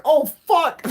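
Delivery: high, loud wailing curse; acted-out cry of terror; freaking out. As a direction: re-enact the panic of a near collision. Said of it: "We're, like, freaking out".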